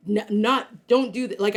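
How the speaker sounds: ordinary talk, nothing marked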